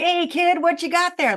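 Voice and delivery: mild imitation of a calm, kind mentor; relaxed old vet. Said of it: "so relaxed, so calm".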